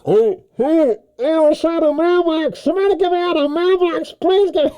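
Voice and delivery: high, hammed-up scream from inside the mailbox; mock terror. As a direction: wail for rescue, overplayed and high. Trapped in mailbox by King Kong.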